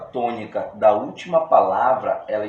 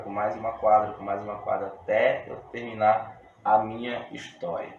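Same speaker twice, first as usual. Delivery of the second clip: usual speaking voice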